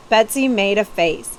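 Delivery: ordinary delivery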